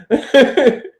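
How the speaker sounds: loud amused laugh; burst of laughter. Real, not acted. Cracking up at the co-host's joke.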